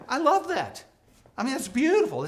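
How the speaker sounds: high, loud delight; bursting with delight; gushing. Real, not acted. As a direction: swoon over how lovely it is. Gushing about family love.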